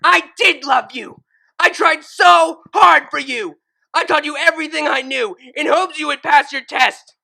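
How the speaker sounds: high, loud furious screaming; seething scream. Acted. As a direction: scream back with pure hate. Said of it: "screamed in return".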